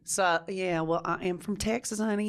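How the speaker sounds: Southern accent. Shown as a demonstration. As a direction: put on a Southern accent from Texas. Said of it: "You're Southern".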